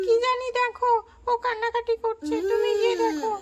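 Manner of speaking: put-on high, loud, tearful child pleading; a frightened young girl trying to hush a crying child; upset child voice. Babli calling aunt for help, high tearful child voice.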